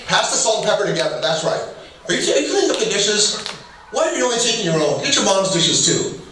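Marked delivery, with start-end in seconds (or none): 1.6-6.2 put-on higher, barking at the kids; a jerky dad snapping at his kids at dinner; cranky dad. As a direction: scold the kids over the dishes. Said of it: "I was kind of a jerk".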